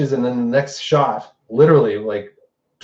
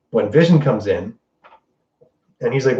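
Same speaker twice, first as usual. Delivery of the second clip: usual speaking voice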